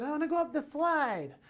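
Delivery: put-on high-pitched eager kid; small child begging to play; little boy's voice. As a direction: say it high like an excited three-year-old. Young son's wish acted out.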